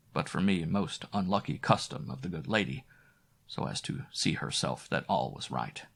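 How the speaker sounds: ordinary delivery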